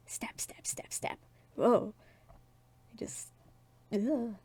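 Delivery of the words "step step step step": whispered footsteps. Acted. Footsteps sound effect narrated.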